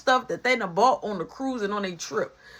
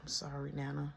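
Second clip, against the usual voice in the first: much softer, low apology; sheepish apology; 7 semitones lower, 16 dB quieter than usual. Retold or imitated. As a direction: mumble sorry to your nana. Kid apologizing to nana.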